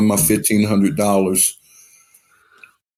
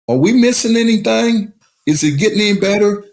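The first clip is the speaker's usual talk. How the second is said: higher, teasing innocent ask with a laugh; mock innocent question; 9 semitones higher than usual. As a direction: play the friends baiting him, all innocence. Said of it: "they go".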